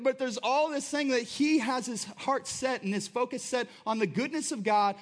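ordinary talk, nothing marked

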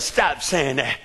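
a hint of the wife telling him off quietly; wife's rebuke. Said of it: "She said".

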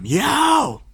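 high, drawn-out holler; long high-pitched "yo" to get attention; retold yell. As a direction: call out to him in a high yell. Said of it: "so I yell at him".

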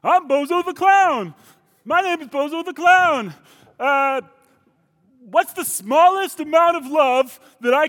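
very high, goofy clown voice; Bozo the Clown. Acted. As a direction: play a silly falsetto clown. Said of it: "here's my impression of Bozo the Clown".

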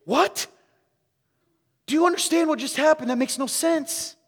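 high, loud disbelieving cry of what; incredulous outburst. Retold or imitated. Preacher acting out his reaction to a member's story, stunned and loud. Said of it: "and I'm going".

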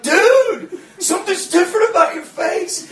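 high, startled exclamation; retold amazement. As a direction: blurt it out in astonishment. Retold shock at changed face.

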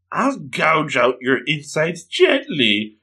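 slow threat into high giggle; gleeful ghoul menace. Acted. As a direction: draw out the threat, end on a high tee-hee. Ghoul threatens victim sweetly.

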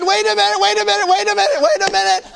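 high, loud pleading "wait a minute"; urgent repeated wait. Retold or imitated. Preacher retelling how he halted her, loud and high. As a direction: talk her down, laughing a little.

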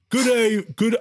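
put-on high-pitched go at a comic French accent; attempted French accent. Caller starts his restaurant pitch, in a hammed-up French accent. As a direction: launch brightly into a dubious French accent. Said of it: "I'll do my best French accent".

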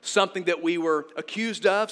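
ordinary talk

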